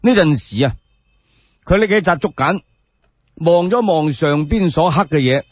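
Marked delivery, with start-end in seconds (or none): none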